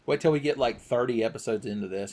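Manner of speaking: usual speaking voice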